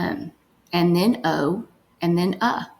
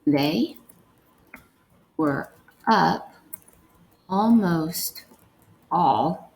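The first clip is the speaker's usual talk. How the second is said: one word at a time, long pauses; word-by-word dictation; slower than usual. Shown as a demonstration. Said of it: "The first one we're gonna write is".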